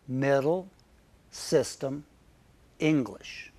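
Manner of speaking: "Middle", "system", "English" each spoken slowly alone with a short ih sound; vowel demo. Shown as a demonstration. Teacher modeling the short i in "Middle", "system", "English", careful and slow. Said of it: "Vowel number two is I".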